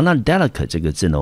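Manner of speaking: usual speaking voice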